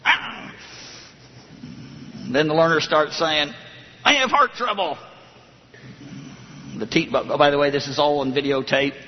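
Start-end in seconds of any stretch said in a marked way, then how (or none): 0.0-0.5 high, brief imitation cry; mock yell of pain. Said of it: "starts yelling". 4.0-5.0 high-pitched mock plea; imitated distressed learner. Voices the suffering learner behind wall.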